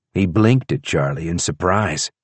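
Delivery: plain reading voice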